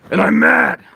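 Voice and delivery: very high, full-force bellow; battle-cry shout. Acted. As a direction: roar it out as an acted king. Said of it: "And I go".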